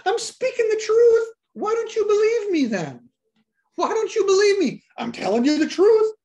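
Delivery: high, loud exasperated plea; pleading cry. Retold or imitated. Jesus pleading with the crowd to believe him, high and loud. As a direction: cry out why won't you believe me.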